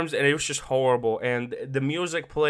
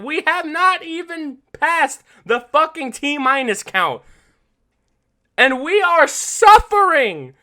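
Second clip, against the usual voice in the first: rising, louder, slower hype; excited build-up; 11 semitones higher, 9 dB louder than usual. Real, not acted. Podcaster hyping the game's opening to listeners, excited. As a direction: pile it on, getting louder and higher. Hyping how early the tragedy hits.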